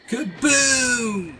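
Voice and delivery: high, loud, held "boom" that drops at the end; high-pitched sound-effect shout; mock explosion cry. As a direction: belt out a drawn-out cartoon kaboom. Mimicking game's explosion cry.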